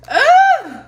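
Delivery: a sharp, high, loud cry of release; loud frustrated ah. Real, not acted. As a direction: let out the pent-up feeling in one burst. Overwhelmed before telling hard story.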